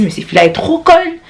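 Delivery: a high, scornful jab at the coworker; exasperated. Retold or imitated. Telling friends about her coworker, scornfully high. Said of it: "J'ai vraiment dit".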